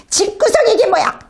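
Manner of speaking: hammed-up furious mom yelling at the messy house; high mock tirade, last words slowed and muttered; nagging mom rant. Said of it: "막 불평하고 화를 내고".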